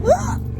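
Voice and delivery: sharp high hiccup gasp; sudden squeaky hiccup. Real, not acted.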